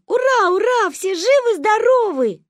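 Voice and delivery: put-on high, loud children's hurrah; yelled cheer. A narrator's reading. Children jumping round parents, jubilant yelling. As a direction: holler joyfully, stretching the words. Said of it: "прыгали вокруг и орали".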